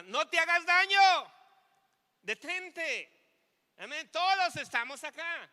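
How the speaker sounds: high, loud cry to stop him; Paul shouting. Retold or imitated. Paul shouts to the jailer to stop him, loud cry. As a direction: yell it out to save the jailer. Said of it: "Y Pablo le grita".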